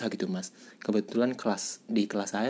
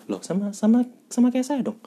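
much higher and louder, thrilled at the coincidence; surprised delight; 14 semitones higher, 4 dB louder than usual. Real, not acted. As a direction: jump up in pitch, delighted it's the same town. Host finds they share hometown.

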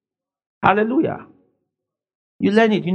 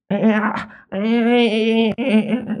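mock whimpering; 4 semitones higher than usual. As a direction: whimper wordlessly like a complainer. Acts out own whining to God.

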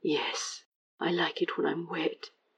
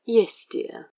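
higher, louder, sing-song condescending yes dear; 5 semitones higher, 7 dB louder than usual. A narrator's reading. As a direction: humour him like a patient spouse. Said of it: "the very picture of condescension".

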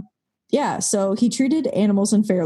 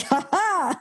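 mock evil laugh; 9 semitones higher than usual. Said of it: "I'm like".

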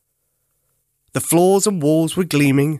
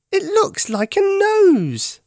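very high, slow wondering teddy voice; Teddy amazed; 3 dB louder, slower than usual. A narrator's reading. Teddy gazing at the button, very high and slow in wonder. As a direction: marvel at it slowly. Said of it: "he said".

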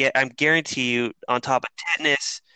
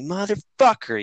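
higher, slow drawn-out curse; retold angry curse; 8 semitones higher than usual. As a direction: let the curse stretch out in frustration. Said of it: "I'm like".

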